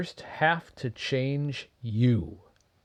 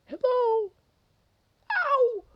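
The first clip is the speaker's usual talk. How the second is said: very high squeaky mouse yelp; tiny mouse cry; 4 dB louder than usual. Acted. Mouse trodden on, cries out.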